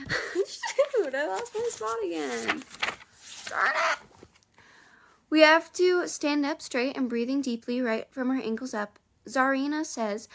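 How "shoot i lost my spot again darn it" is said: frustrated yelp. Real, not acted. Reader loses place, frustrated.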